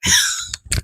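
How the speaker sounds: high breathless giggle; a squeaky laugh that breaks the impression. Mid imitation laughing.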